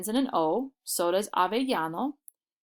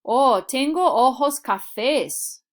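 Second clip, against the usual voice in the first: higher and louder, slow, bright answering voice; cheerful answer demo; 4 semitones higher, 7 dB louder, slower than usual. Shown as a demonstration. Learner answering the eye colour question, cheerful and slow. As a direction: answer like a student replying in class. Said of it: "What color of eyes do you have?".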